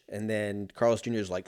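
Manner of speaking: ordinary talk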